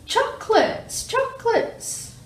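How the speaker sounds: high, slow echoing calls; drawn-out ringing echo. Acted. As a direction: duck posing as the cave echo. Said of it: "the voice from the cave replied".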